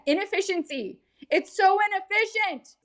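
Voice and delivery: very high, put-on voice of exasperation; exasperated squeal. Mock outrage over wasted time.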